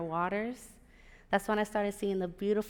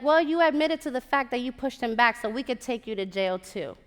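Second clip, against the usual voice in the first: higher, louder mock police retort; officious retort; 3 semitones higher, 7 dB louder than usual. Cops answering her complaint, officious retort. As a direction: imitate cops turning it back on her.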